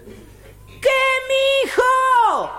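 very high, stretched holler back; old father calling back across the countryside; shouted long-distance answer. Acted. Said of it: "Al rato le contestó el viejito".

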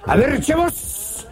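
eerie voice hailing a passer-by; mysterious voice. Acted. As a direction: call out slowly as the unseen cemetery voice. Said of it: "siente una voz que le dice".

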